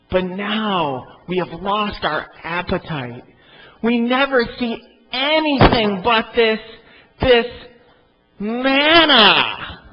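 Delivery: whining, rising to a loud high wail on manna; whiny voice. Acted. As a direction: build the whine to a disgusted cry. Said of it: "I started to hear this in a whiny voice".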